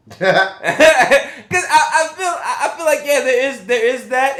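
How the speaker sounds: high laughing speech breaking through the sentence; giggling as the words come out. Real, not acted. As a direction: talk while laughing out loud. Laughing while explaining a point.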